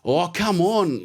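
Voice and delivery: high, slow, drawn-out Aussie cat voice. Retold or imitated. Reading Chatterpuss from a book in an Aussie cat accent. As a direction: stretch it out as an Aussie cartoon cat. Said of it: "this Aussie cat".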